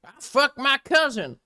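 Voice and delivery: mocking southern accent. Retelling a friend's mockery of Lyle, fake Southern accent. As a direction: ham up a slow Southern drawl. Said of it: "Robert was mocking his southern accent".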